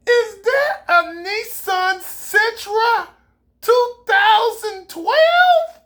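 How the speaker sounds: teasing rapper about his cheap car; mocking question. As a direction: drag it out in mock disbelief.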